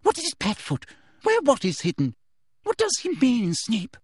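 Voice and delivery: high eager questions; eager cry. A narrator's reading. Umbridge eagerly questioning Snape, high and eager. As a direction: pounce on it greedily. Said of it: "cried Professor Umbridge".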